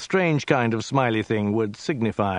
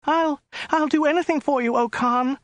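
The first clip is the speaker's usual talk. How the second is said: very high falsetto woman, stammering; terrified squeaky offer; frightened stammer; 3 dB louder than usual. A narrator's reading. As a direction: plead high and panicky, tripping on the start. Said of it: "stammered the woman".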